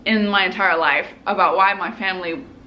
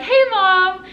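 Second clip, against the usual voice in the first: high, louder hello back; daughter's greeting; 9 semitones higher, 5 dB louder than usual. Retold or imitated. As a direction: mimic greeting your mom in a high voice. Own greeting back, acted.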